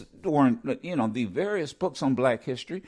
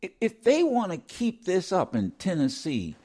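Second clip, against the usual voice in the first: ordinary talk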